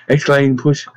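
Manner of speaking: usual speaking voice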